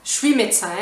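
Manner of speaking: "je suis" merged into "chui" before "médecin"; contracted pronunciation. Shown as a demonstration. Teacher showing how the words pile up, contracted pronunciation. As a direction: run "je suis" together as one syllable, "chui". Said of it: "puis on dit".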